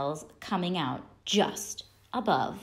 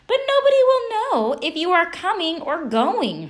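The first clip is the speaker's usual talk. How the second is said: exasperated mom; 11 semitones higher, 5 dB louder than usual. A narrator's reading.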